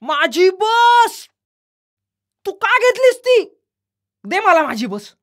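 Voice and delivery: put-on high, loud furious boy's yell; child yelling. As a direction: scream for the toy bus, stretched out. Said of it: "यश ओरडला". (बस हिसकावणाऱ्या मुलाचे मोठ्याने ओरडणे)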